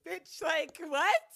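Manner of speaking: incredulous outburst. Real, not acted. Outraged at broke pop star life.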